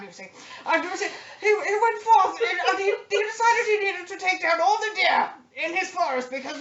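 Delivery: put-on high, loud, pompous old English gentleman, laughing through it; posh old English hunter. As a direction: spin a grand tale like a whiskey-sipping old aristocrat. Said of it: "started talking like this".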